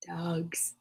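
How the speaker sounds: whisper. Acted. Hushed awe at dogs.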